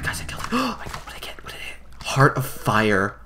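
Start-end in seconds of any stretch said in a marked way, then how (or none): 0.0-1.9 soft, unvoiced eager whisper; excited whisper. Real, not acted. Gamer checking loot after the kill, excited whisper. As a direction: whisper it in awe.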